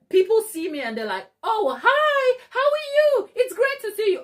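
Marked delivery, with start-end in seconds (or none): 1.4-4.2 high, loud gushing hello; so-friendly greeting. Retold or imitated. People spotting her in town, gushingly friendly. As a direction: imitate an effusive greeter, pitched way up. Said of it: "the people are so friendly".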